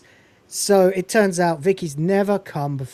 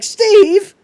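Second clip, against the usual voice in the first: sharp high call of his name, much louder; 10 dB louder than usual. Retold or imitated. Girl calling out to Steve, high and loud.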